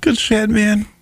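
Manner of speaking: choked up, voice cracking high; real tears breaking through. Real, not acted. Thanking listeners for the GoFundMe, voice cracking with real tears. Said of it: "the guy who's actually crying".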